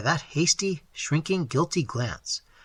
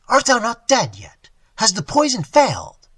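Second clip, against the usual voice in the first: higher, louder sneering challenge; harsh demand; 8 semitones higher, 7 dB louder than usual. Acted. Robber realising the poison failed, loud harsh demand. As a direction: spit the question at him. Robber confronts old man.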